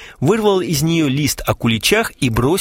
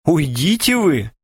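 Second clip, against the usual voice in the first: slow, higher, mildly angry brush-off; cross; 5 semitones higher, slower than usual. A narrator's reading. Маруся brushes off the men's apology, cross. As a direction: push him away with an understated huff. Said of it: "сердито сказала Маруся".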